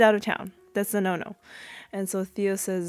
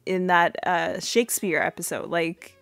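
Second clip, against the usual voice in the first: normal talking voice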